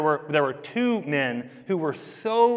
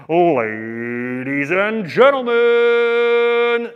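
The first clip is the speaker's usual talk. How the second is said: huge drawn-out ring announcer call, higher and louder; Michael Buffer; 5 semitones higher, 8 dB louder, much slower than usual. Retold or imitated. Boxing announcer introducing the main event, booming and very slow. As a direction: stretch every syllable like opening a title fight. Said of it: "he was like".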